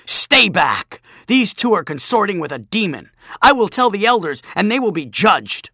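loud, high, self-important shouting; puffed-up bully. Acted. Bully warning the girl off, loud pompous threats. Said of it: "Jachin puffed up like a toad".

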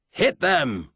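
mild bellowed battle order; commanding shout, held slightly in check. A narrator's reading. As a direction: bellow the command, voice falling sharply at the end. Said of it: "he bellowed".

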